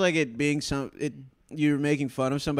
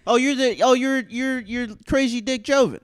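higher, louder heckler recognizing someone; lightly acted excited old classmate; mock recognition; 9 semitones higher, 4 dB louder than usual. Old classmate recognizing the comic in the audience, mock recognition.

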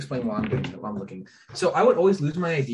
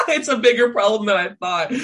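high laughing admission; 9 semitones higher, 6 dB louder than usual. Real, not acted. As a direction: laugh while admitting it. Laughing at pile of IDs.

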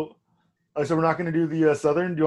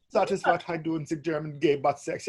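softer mock German accent; 6 dB quieter than usual. Shown as a demonstration. Wrapping up the demo for co-host, mock German accent. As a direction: settle back into a joking German accent. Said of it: "talk with a little German accent".

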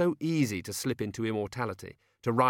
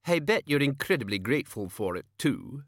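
sharp, higher-pitched page's voice; 5 semitones higher, 3 dB louder than usual. A narrator's reading. Page demanding gratitude from old man, sharp. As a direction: prod him sharply, pitched up.

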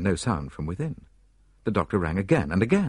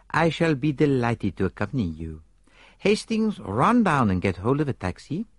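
lightly voiced Poirot; 6 semitones higher than usual. Poirot offers to go.

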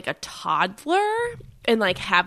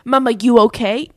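put-on toddler voice; 2 semitones higher, 10 dB louder than usual. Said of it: "she go".

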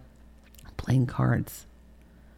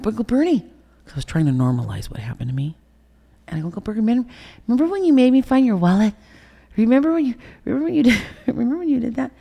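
put-on high, loud little-girl voice; innocent child; 10 semitones higher, 4 dB louder, faster than usual. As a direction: ask it like a naive four-year-old. Child voice retold to uncle.

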